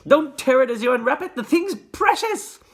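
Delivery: excited shouting. A narrator's reading. Shopkeeper keeps shouting.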